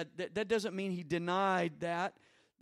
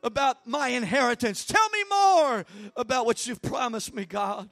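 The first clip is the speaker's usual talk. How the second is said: loud, higher crying out to God; shouted prayer; 6 semitones higher, 9 dB louder than usual. Real, not acted. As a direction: pray at full force. Prayer at full force.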